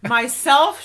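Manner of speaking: startled loud question; surprised. Real, not acted. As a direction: caught off guard, rising. Surprised at being asked personally.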